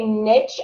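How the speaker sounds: niche as "nitch"; short-vowel niche. Shown as a demonstration. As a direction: say niche to rhyme with pitch. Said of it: "do you say niche".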